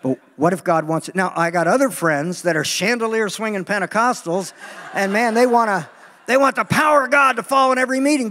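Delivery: normal talking voice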